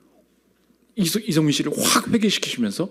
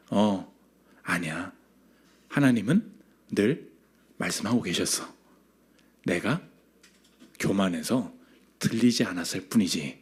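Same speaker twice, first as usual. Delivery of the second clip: very soft, low, halting reply; quiet, low admission with long pauses; hushed confession; 8 semitones lower, 8 dB quieter than usual. Retold or imitated. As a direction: imitate a humbled mother confessing, pausing between phrases. Retold humble confession, voiced softly.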